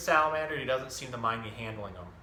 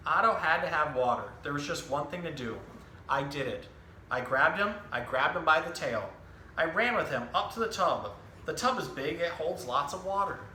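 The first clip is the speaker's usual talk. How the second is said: lively picture-book storytelling voice, each line clearly paced; reading to children; 3 semitones higher than usual. Acted. Zookeeper reading a book to children, animated storytelling. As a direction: tell the story to a room of young kids.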